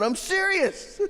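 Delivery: very high, squeaky imitated protest; insistent protest. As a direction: pitch leaps up in mock indignation. Protesting to friends who disbelieve him.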